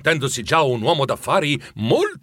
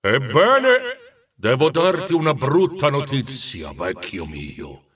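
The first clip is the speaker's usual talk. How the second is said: ghost's announcement; slower than usual. A narrator's reading. Ghost breaking bad news to Scrooge, weighty and theatrical. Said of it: "annunciò il fantasma".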